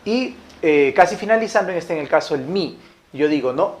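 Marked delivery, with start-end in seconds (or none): none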